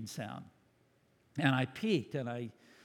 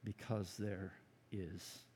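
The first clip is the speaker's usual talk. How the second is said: softer, lower preacher hush on the final words; hushed emphasis; 7 semitones lower, 13 dB quieter, slower than usual. Acted. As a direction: drop to a near whisper. Sermon climax dropping to whisper.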